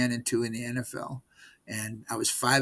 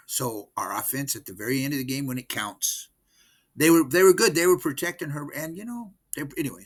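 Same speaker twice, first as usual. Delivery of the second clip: ordinary delivery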